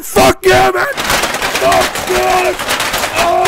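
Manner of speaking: screaming loud and high; furious shout. Real, not acted. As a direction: yell it in a rage. Raging at the game.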